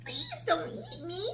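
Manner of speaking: slow, soft, frightened plea; pleading. A narrator's reading. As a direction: beg for your life. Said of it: "pleaded Sarah".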